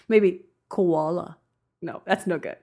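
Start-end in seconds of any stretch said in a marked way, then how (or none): 0.1-1.4 slow, tentative Australian accent; lightly put-on Australian accent, drawn out; attempted Australian accent. Shown as a demonstration. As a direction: try it in a rough Australian accent. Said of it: "only Australian thing that I know how to say".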